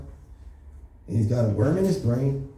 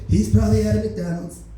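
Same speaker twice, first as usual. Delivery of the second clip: lightly voiced Trump, a bit higher and louder; Donald Trump voice; 8 semitones higher, 4 dB louder than usual. Trump sends police after the shooter, lightly voiced Trump. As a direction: restart the line, now in the Trump voice. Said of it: "I gotta do the voice".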